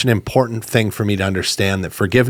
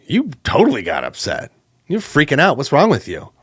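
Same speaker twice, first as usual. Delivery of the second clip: louder, higher scornful jeer; mocking accusation; 6 semitones higher, 4 dB louder than usual. Pal scorning an apology, mocking and accusing. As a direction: throw it back at him, pitched up.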